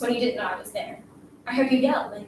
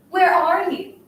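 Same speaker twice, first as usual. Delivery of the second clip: higher, louder calling voice; 6 semitones higher, 5 dB louder, slower than usual. Acted. Girl prompting classmate to call her sister, loud call. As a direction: call out loud enough to carry. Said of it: "Say it loud enough for your sister".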